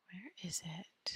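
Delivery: soft unvoiced whisper to herself; hushed searching whisper. Real, not acted. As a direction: whisper it as you hunt for the button.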